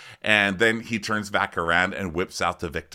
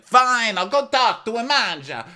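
much higher and louder huff; mock huffy Gia; 14 semitones higher, 6 dB louder than usual. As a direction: give in with a loud high huff. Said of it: "Gia's like".

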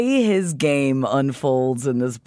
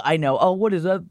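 usual speaking voice

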